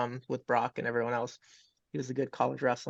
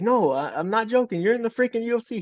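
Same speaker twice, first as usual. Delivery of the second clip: higher and louder, imitating an excited buddy; 9 semitones higher, 7 dB louder than usual. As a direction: retell a friend's thrilled shout of good news. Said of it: "He's laughing".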